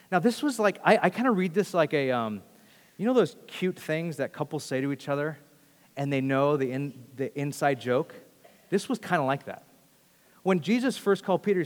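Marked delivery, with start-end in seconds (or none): none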